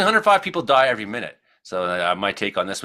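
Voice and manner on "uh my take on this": ordinary talk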